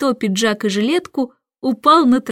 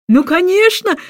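high, louder delighted cry; exclaiming; 9 semitones higher, 4 dB louder than usual. A narrator's reading. King cries out, delighted by his success, high and loud. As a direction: burst out, pleased with success. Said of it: "вскричал король".

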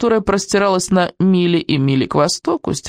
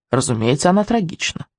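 ordinary delivery

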